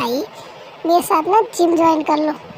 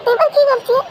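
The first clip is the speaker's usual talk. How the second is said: sarcastic blame; 7 semitones higher than usual. Acted. Wife sarcastically blames brother-in-law.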